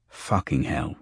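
low, softly spoken swear; soft oath. A narrator's reading. Petal sees them there with their bags, softly. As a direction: swear under your breath, softly. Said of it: "he said softly".

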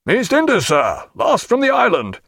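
high-pitched shouted reply; called-back answer. A narrator's reading. Junior captain answering his senior across water, called out high. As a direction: call back to a superior.

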